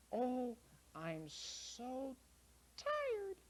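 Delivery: very high, slow and weary; little girl whining about being tired. Acted. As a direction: drag it out like a sleepy child. Lara tired.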